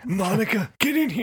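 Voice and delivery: high, strained Bill Clinton voice; Bill Clinton impression. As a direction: Clinton in need of water. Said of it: "You sound like Bill Clinton that needs a glass of water".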